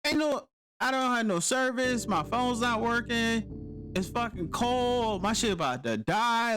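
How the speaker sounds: high, pressed list of woes; stranded soldier complaining to the rescuer; frantic complaint. Retold or imitated.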